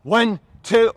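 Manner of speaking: loud drill-style cadence; shouted count. Real, not acted. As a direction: shout the numbers in steady rhythm. Trainer calls out exercise count.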